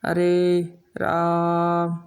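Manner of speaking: long prolonged "राम" for pluta; drawn-out pluta vowel. Shown as a demonstration. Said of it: "लंबी आवाज़ खींचा जाए".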